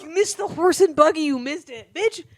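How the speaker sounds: lightly mocking high-pitched echo; mocking imitation. Mocking co-host's missed-buggy remark, lightly mocking. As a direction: tease with a mildly put-on voice. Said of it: "he keeps being like".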